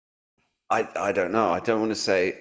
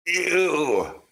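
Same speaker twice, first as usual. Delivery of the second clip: high, drawn-out "ew" of disgust; 8 semitones higher than usual. Real, not acted. Host reacting to speculation about a politician's rise, disgusted groan. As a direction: recoil with a long, raised ew.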